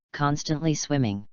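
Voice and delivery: ordinary delivery, nothing marked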